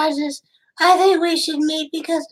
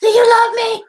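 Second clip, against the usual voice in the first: tearful plea; 6 semitones higher, 6 dB louder than usual. Pretend. Pretend needy caller pleading.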